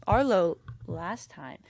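normal talking voice

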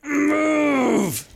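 high, strained acted cry; paralyzed victim. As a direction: strain each word out as if frozen stiff. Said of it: "you hear him kinda go".

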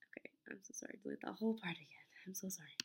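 whispered apology, breathy and soft. Real, not acted. Apologizing to Caesar in a whisper while recording. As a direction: hush it, sorry under your breath.

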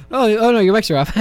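ordinary talk, nothing marked

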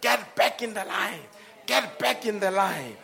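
mock scream at the top of the voice; retold scream. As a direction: call it out loudly, re-enacting a desperate cry. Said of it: "I screamed at the top of my voice".

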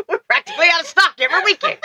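high-pitched, hammed-up sales pitch; excited mock salesman, breaking into laughter; mock store pitchman. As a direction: pitch the goods like a cheesy advert. Continues the mock store commercial.

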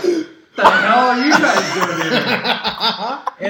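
put-on loud, high, drawn-out drunken question; slur-slow mock surprise from the couch; hammed-up drunk greeting; imitated drunk. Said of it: "fucked up on the couch".

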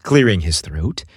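plain reading voice

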